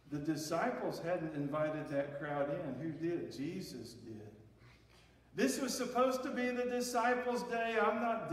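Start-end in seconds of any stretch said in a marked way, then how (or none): none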